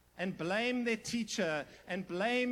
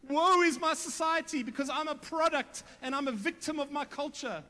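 high, loud self-pitying whine; mock a victim blaming everyone else; hammed-up whiny excuse; 6 semitones higher, 5 dB louder than usual. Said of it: "He doesn't go".

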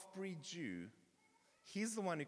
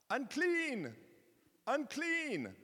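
high, loud warning shout; crying out; 9 semitones higher, 5 dB louder, much slower than usual. Acted. Leper warning people around him in town, crying out. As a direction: call it out to keep people away. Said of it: "had to cry out".

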